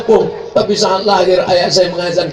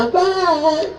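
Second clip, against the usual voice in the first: sweet wife; 10 semitones higher, 4 dB quieter than usual. Retold or imitated.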